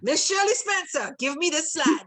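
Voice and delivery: loud, high call to Shirley by name. Real, not acted. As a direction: call her name across the room, playfully. Calling out to attendee.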